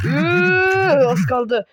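normal talking voice

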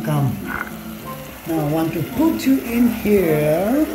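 ordinary talk, nothing marked

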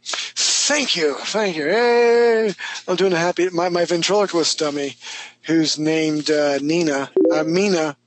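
usual speaking voice

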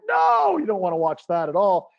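high, loud "no", then easing down; mock-panicked refusal; alarmed parent. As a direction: cry out to stop the kids. Parent stops kids watching TV.

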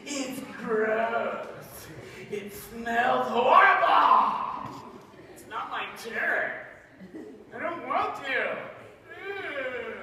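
high, laughing, drawn-out whine; whiny kid. Acted. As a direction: whine like a kid asked to take out trash. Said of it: "and you're like".

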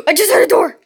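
fast, loud, startled report; startled gasp. Real, not acted. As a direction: blurt it out in alarm. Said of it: "first take, startled".

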